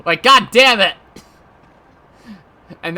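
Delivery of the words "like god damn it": loud, higher yell of frustration; frustrated outburst. Pretend. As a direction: yell it like you just woke from a perfect dream. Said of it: "I'm gonna be so fucking pissed".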